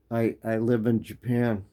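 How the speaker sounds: normal talking voice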